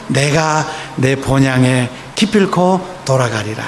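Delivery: low, slow, solemn resolve; hushed determined promise; solemn vow. Acted. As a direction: vow it quietly to yourself, slowly. Pilgrim's inner vow, quoted.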